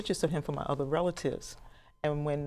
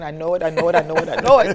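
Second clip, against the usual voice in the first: louder and louder through laughter; giddy excitement; 3 semitones higher, 12 dB louder, faster than usual. Retold or imitated. Telling coworkers she's having a big one, laughing. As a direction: bubble over with certainty. Coworkers ask what's wrong.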